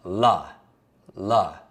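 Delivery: "L" sounded out as luh. Shown as a demonstration. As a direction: say "L" as luh, twice, separately. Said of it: "Five is the L sound".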